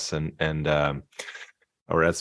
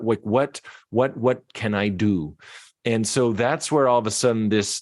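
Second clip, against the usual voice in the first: usual speaking voice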